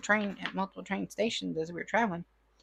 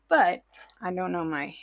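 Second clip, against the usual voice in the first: normal talking voice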